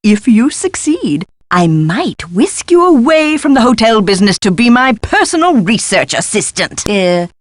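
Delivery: ordinary talk, nothing marked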